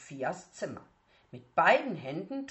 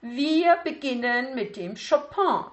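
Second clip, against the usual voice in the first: strict teacher voice, higher and louder; a demanding piano teacher opening the lesson; Klavierlehrerin, streng; 8 semitones higher, 8 dB louder than usual. Acted. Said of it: "sagte Frau Leberknecht".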